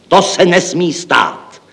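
slow, high cry of protest; exclaiming. Acted. Chief accountant objects to unspent money, exclaiming slowly and high. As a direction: call it out emphatically, drawing every word out. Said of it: "zvolal hlavní účetní".

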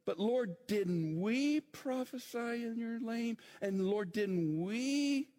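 high, drawn-out WE in a plea; pleading claim that leans long on "we"; stress on WE. Retold or imitated. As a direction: stretch the word we in each appeal. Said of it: "Notice what the emphasis on there".

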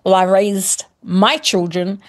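usual speaking voice

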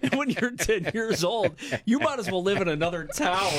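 high-pitched, talking through laughter; laughing. Real, not acted. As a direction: struggle to tell it while cracking up. Cracking up at dad's prank.

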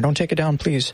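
ordinary talk, nothing marked

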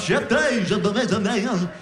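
garbled, warped high babble like a record played backwards; mock possessed sleep-talk gibberish. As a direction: babble nonsense like a reversed tape. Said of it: "Sounds like you're turning a record backwards".

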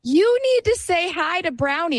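put-on a small kid demanding politeness; child voice. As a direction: son insisting to the lady, high child voice. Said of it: "he's like".

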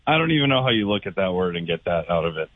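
normal talking voice

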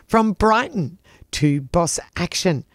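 normal talking voice

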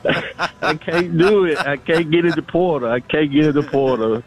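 high, laughing mock refusal. Caller retelling turning down the transfer portal, laughing and high. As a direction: laugh it off while insisting. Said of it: "but I said".

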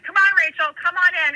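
imitated urgent coaxing; beckoning call. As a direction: wave someone in, calling out. Cousin waving her in, retold.

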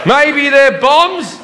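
very high, slow, drawn-out innocent voice; hammed-up clueless self. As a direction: play the dopey passenger stretching every word. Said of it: "And I went".

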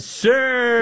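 a dainty high Sir; mock Melania. Host mimics Melania calling the president Sir, high voice. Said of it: "and she said".

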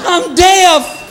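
startled by a dog that barks without sound; mock panic. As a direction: yell out in fake alarm at the silent dog. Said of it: "You're like".

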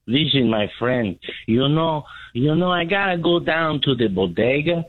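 a hint of a Spanish-speaking neighbor. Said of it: "do you not have a Mexican?".

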